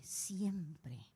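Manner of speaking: whisper. Real, not acted. Preaching, hushed emphasis.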